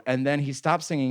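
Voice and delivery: normal talking voice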